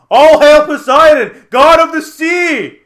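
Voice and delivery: very loud, high, drawn-out proclamation; slow, full-voiced heroic yell; yelling battle cry. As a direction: bellow it with the trident held high. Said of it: "he's yelling this at the crab".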